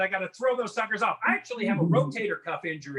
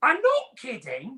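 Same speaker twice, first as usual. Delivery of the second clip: higher, slow mock British accent; 5 semitones higher, slower than usual. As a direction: insist like a know-it-all Brit. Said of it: "their accent makes them sound like they know everything".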